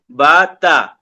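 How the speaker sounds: تا said light and thin; light unemphatic تا. Shown as a demonstration. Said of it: "باریک پڑھ لیں".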